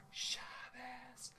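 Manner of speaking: soft whispered echo. Shown as a demonstration. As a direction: lean in and whisper it. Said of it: "I'm doing the whisper voices".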